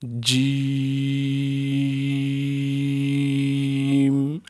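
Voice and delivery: جیم with the 'ee' held very long on one note; madd elongation. Shown as a demonstration. Teacher leading pupils to read along, long-drawn جیم. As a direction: stretch جیم steadily, closing on 'm'. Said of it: "آخر میں میم کی آواز بھی آنی چاہیے".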